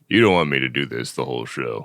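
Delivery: ordinary talk, nothing marked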